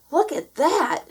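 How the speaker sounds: high, loud delighted cry; excited shout. A narrator's reading. Minnie shouting at the rolling hay bales, thrilled. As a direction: shout it in wonder. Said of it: "she shouted".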